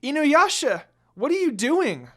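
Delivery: high, loud cry of pain and shock; shrieking Inuyasha's name in pain; pained scream. Acted. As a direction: scream it as the arm is slashed. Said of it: "She screamed in pain".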